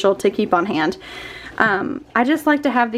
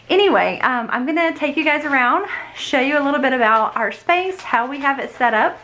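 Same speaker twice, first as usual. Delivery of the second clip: usual speaking voice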